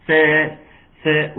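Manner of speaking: سا with a thin, light س; ساء kept light and unemphatic; light seen. Shown as a demonstration. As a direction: demo سا light, not heavy. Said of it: "كل ده مرقق".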